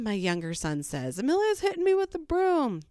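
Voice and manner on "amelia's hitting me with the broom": put-on high-pitched complaining little boy; tattling child. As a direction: imitate a young son tattling. Younger son tattles to mom.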